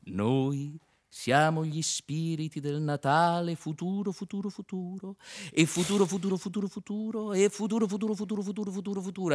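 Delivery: eerie spirits echoing the word; ghostly echo. Acted. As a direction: chant it like a spectral echo. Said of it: "ghost chants future".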